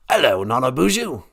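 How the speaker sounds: lightly voiced spirit with an odd accent; Great Spirit character. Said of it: "accent is from".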